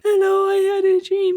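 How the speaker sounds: high, strained sleepy voice; groggy morning voice. Retold or imitated. Mocking her own dream recordings in a high sleepy voice. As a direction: imitate yourself just woken up. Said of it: "these recordings, I'm like".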